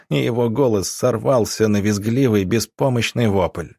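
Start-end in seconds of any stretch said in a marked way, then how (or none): none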